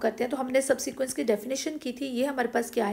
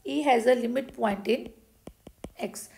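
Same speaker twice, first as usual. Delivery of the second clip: slow dictation; much slower than usual. Shown as a demonstration. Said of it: "dictating theorem slowly".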